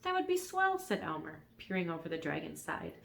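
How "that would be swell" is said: put-on high, bright boy voice; pleased little boy; delighted boy voice. Elmer voiced in story.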